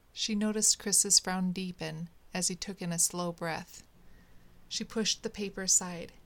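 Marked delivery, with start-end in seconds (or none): none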